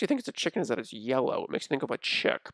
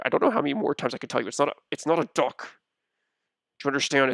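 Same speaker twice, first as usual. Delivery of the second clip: ordinary delivery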